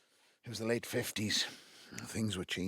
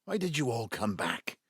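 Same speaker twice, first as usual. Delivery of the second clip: much louder, raised voice; angry shout; 5 semitones higher, 10 dB louder than usual. Acted. Father shouting at his gathered children, angry. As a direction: hurl it at them all.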